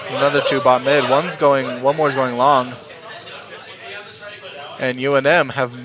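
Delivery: caster rising from calm to hype; steady call swelling into excitement; play-by-play commentary building up. Acted. Casting the match, building hype.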